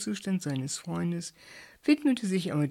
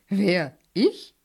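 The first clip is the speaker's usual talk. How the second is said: louder surprised question; astonished; 2 semitones higher, 6 dB louder than usual. A narrator's reading. Bert is astonished at being called too scientific, surprised. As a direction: ask in disbelief. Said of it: "fragte Bert erstaunt".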